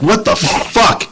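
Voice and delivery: high, slow, stretched-out disbelief; shocked outburst. Retold or imitated. While driving, hearing his team scored, shocked what-the-fuck. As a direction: cry it out as the goal goes in.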